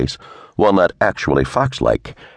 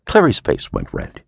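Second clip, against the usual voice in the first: usual speaking voice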